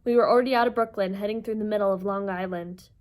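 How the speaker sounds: plain reading voice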